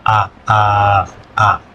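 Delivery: drawn out A in A, A, A, pure and open; Spanish vowel demo. Shown as a demonstration. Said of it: "In English".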